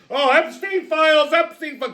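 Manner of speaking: high mock Democrat chant; mocking whine. Mocking Democrats' distraction, high mock whine. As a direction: parrot them like a whiny crowd. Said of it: "deflect, sleight of hand".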